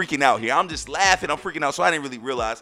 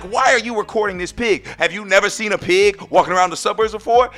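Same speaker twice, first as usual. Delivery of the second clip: mock indignant questioning, higher and louder; imitated judgmental neighbors; 5 semitones higher, 7 dB louder than usual. Neighbors to the man filming their pig, imitated indignant disbelief. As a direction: act out their baffled disapproval. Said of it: "That's how they looked at me".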